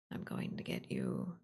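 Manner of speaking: low, ghostly monster whisper; creepy playful under bed whisper. Pretend. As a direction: breathe it like a thing under the bed. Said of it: "the thing under your bed".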